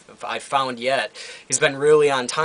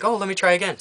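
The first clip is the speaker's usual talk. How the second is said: higher, apologetic shop-clerk voice; imitating the Costco cashier, higher voice; mock cashier voice; 7 semitones higher, faster than usual. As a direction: play the polite cashier, pitch up. Store cashier quoted by customer.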